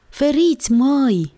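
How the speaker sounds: high calling-out to clear the way; high-pitched, drawn whoop of children; children's whooped warning. A narrator's reading. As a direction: holler a warning like excited kids. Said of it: "chiuiră copiii".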